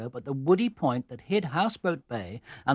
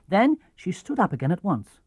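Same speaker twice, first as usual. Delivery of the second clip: usual speaking voice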